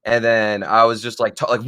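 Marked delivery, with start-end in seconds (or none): none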